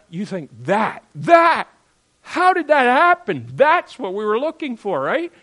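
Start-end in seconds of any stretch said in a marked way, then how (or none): 0.6-3.5 loud, high astonished outburst; acted amazement. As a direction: play a parent stunned by the change. Acting an astonished parent's reaction.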